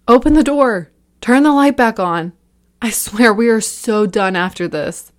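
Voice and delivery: loud, high, breathless panic; panicked shouting. A narrator's reading. Liam trapped in the dark, shouting in panic. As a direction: shout in terror, near hyperventilating. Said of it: "Liam was almost hyperventilating".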